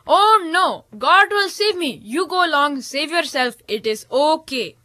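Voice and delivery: high, gently insistent; lightly voiced holy man; serene refusal. A narrator's reading. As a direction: Manda Baba waves the boat on. Manda Baba refuses boat.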